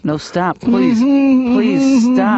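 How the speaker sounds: pleading, rising high and loud while tickled; begging through tickling. Real, not acted. Patient being tickled by therapist, high loud pleading. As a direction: squeal at him to stop the tickling.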